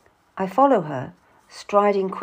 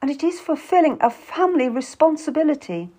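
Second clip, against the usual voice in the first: high, insistent appeal; pleading; 8 semitones higher, 3 dB louder, faster than usual. A narrator's reading. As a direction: argue your case beseechingly. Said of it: "Lucy pleads".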